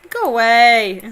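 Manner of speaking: high, loud incredulous "what" then a dismissive "go away"; mock friends' reaction. Streamer imitates her friends reacting to her Swedish claim. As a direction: play the baffled friends. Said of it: "they're all like".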